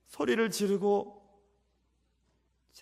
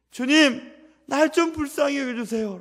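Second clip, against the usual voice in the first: fervent prayer; 6 semitones higher, 6 dB louder than usual. Shown as a demonstration. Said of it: "라고 기도해야 합니다".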